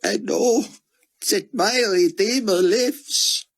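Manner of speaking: put-on squeaked Israeli accent. A narrator's reading. Grieving scholar answers Buck in a high, squeaked Israeli accent. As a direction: squeak it out through a tight throat, grieving. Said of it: "squeaked from a constricted throat".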